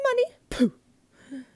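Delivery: high loud laughing dismissal; laughing scoff. A narrator's reading. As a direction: laugh the money away. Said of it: "The glunk just laughed".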